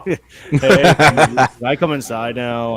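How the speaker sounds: loud, drawn-out pleading call; hammed-up slow holler; mock calling out. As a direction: call to be let in from the backyard. Acts out Mick calling from backyard.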